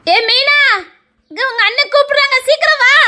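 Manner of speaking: calling out. Acted. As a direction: hurry her over from the next room. Wife calling sister-in-law.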